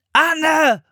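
loud, high-pitched yell; shouted name. A narrator's reading. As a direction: yell out for a missing person. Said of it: "schrie Philipp noch einmal".

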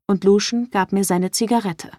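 plain reading voice

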